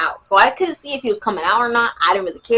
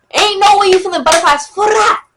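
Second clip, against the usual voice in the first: loud, high self-roast; mock scolding; 9 semitones higher, 6 dB louder than usual. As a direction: tell yourself off, hammed up. Scolds herself in mock disbelief.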